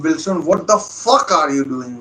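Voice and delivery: frustrated fan shouting at the screen; angry outburst. Real, not acted. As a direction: vent at the player for wasting it. Angry at missed chance.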